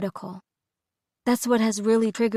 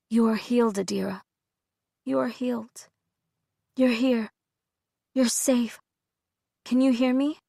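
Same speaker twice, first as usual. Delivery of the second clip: softer, gentle healing words; tender reassurance; 4 dB quieter than usual. A narrator's reading. Dina soothing Adira after the episode, soft and tender. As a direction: soothe her back to herself.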